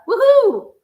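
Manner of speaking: high, loud sarcastic whoop; mock cheer. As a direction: whoop as if celebrating, mockingly.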